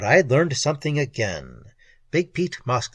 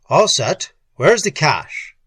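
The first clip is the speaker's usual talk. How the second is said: loud, booming boss; 3 semitones higher, 7 dB louder, slower than usual. A narrator's reading. Boss demands his money, booming voice. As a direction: boom the demand out. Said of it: "voice that could kill horseflies at five hundred feet".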